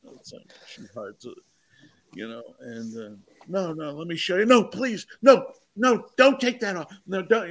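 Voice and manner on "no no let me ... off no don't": begging. Acted. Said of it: "they're begging her".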